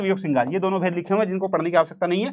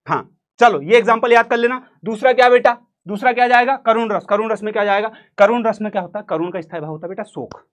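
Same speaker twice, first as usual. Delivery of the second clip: normal talking voice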